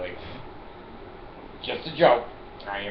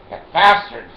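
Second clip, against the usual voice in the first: mock shout; 5 semitones higher, 10 dB louder than usual. Shouting after the passer-by.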